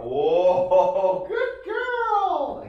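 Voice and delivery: very high, loud, laughing praise; delighted high dog praise; high-pitched praise. Shown as a demonstration. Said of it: "Again, dynamic with the voice".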